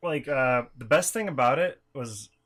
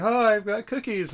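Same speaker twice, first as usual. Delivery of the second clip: cheerful mascot; 10 semitones higher, 4 dB louder than usual. Retold or imitated. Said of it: "I would come around the corner and be like".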